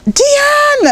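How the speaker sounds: put-on high, bright greeting of a long-lost child; excited call. Mum spotting her daughter at the airport, excited call. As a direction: call her name with joy.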